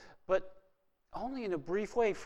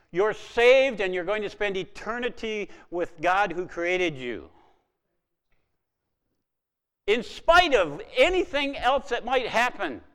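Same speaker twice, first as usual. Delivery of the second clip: louder and higher, slowing on the last line; rising preaching; 5 semitones higher, 9 dB louder than usual. Real, not acted. Preacher assuring the congregation of eternity, building loud. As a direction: build the promise to a shout.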